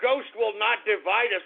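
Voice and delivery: normal talking voice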